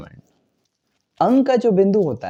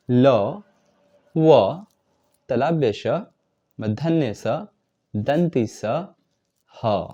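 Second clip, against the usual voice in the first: reciting consonants; 4 semitones lower, much slower than usual. Shown as a demonstration. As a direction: call out each consonant slowly. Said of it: "व्यंजन वर्ण में कौन कौन से वर्ण आते हैं".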